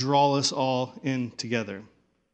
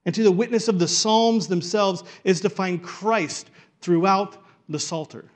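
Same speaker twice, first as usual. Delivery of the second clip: louder, higher preacher's cadence ringing out each word; full-force preaching; 5 semitones higher, 6 dB louder than usual. Real, not acted. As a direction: build it up and proclaim it to the congregation.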